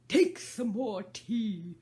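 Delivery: slow, loud earnest offer; a host insisting; earnest March Hare. Acted. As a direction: press the tea on her earnestly. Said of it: "very earnestly".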